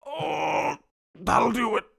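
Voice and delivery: high wheezy last words of a dying king; a hint of a dying king. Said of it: "And he says".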